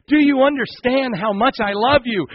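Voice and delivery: high, loud, insistent plea; impassioned pleading. Acted. God pleading with those who ignore him, impassioned. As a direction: preach it as an urgent cry of love.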